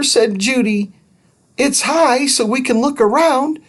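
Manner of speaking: put-on high, lively girl voice. Judy suggests heading for the ear in a high voice. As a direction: voice Judy light and eager.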